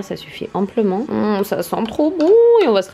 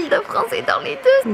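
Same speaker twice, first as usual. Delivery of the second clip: very high, thrilled shriek; excited shriek; 13 semitones higher than usual. Real, not acted. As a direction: shriek it out with joy. Repeats, much higher.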